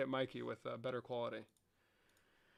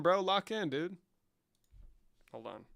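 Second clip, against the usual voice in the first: ordinary talk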